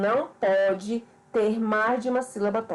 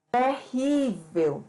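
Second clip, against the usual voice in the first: "Terrível" very slow, RÍ drawn out; teacher's slow example "Terrível", weight on RÍ; stress on the accented syllable; much slower than usual. Shown as a demonstration. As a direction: stretch the middle syllable RÍ in "Terrível". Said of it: "para marcar a sílaba tônica".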